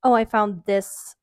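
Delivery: usual speaking voice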